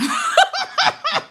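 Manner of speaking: high squealing laugh; real laughter. Real, not acted. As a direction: burst out laughing, pitched high. Laughs at glasses story.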